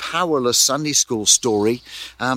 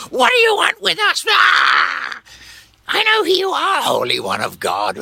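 much higher, louder out-of-control demon shout; wild possessed scream; demonic shrieking; 4 dB louder than usual. Acted. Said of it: "I think it was probably more".

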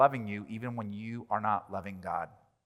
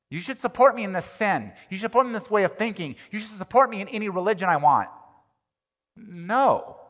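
ordinary talk, nothing marked

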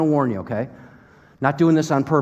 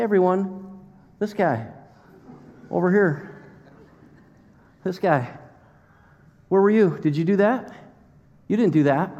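higher-pitched needling questions in short bursts; mock taunting; 4 semitones higher than usual. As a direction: playfully show someone up before onlookers, pausing between jabs. God mockingly quizzes Job before onlookers.

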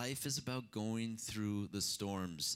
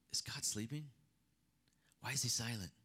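breathy, voiceless, the question barely spoken; hushed whisper. Acted. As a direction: lean in and whisper the doubt. Voicing doubting believers' hushed question.

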